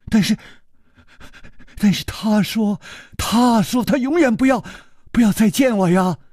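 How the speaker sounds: put-on timid young servant. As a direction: falter out the words, ashamed. Yijian protests.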